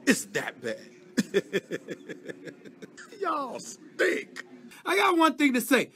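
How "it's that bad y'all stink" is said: mocking laugh at a losing team; scornful laughter; laughing at them. Real, not acted. Laughing at the Cowboys.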